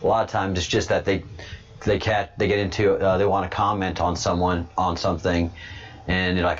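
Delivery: usual speaking voice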